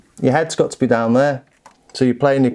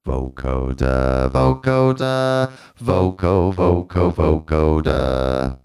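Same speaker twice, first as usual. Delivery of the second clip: robotic chant on held synth notes; vocoder voice; 7 semitones lower, slower than usual. Shown as a demonstration. Reviewer demonstrating the vocoder, stuttered robotic chant. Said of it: "to vocoding".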